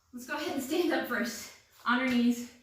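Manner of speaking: ordinary delivery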